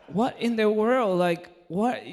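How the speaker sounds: loud, high, drawn-out incredulous cry; exasperated disbelief. Retold or imitated. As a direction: act out total bafflement, big and high. Retold disbelief at falling sick.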